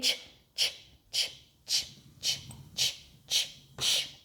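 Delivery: soft, steady unvoiced "ch" puffs; train chugging noise. Shown as a demonstration. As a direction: chug softly like a train on "ch". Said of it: "let's get that train chugging again".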